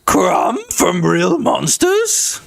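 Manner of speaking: high, drawn-out incredulous question; squeaky incredulous repeat; mock disbelief. Real, not acted. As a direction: echo it slowly, voice cracking upward. Mock disbelief at being compared.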